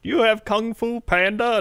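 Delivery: high-pitched imitated old lady; old woman. Old woman asking for a new movie, high mock voice. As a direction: put on a high, querying customer voice. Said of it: "this old woman with Kung Fu Panda".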